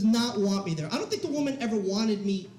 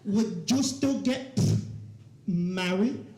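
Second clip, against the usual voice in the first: put-on slow, halting Cuban mom accent; 3 semitones lower, much slower than usual. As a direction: stretch it out in mom's Cuban accent. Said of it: "I'll do the accent for you guys".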